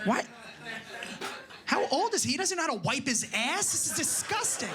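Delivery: high, appalled squeal at dirty boxers; disgusted wife. Retold or imitated. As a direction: shriek in mock horror. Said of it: "and you're like".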